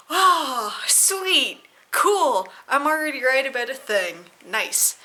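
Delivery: loud, gleeful, sarcastic cheer; mock excitement. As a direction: gloat loudly like you were proven right. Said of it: "And I was like".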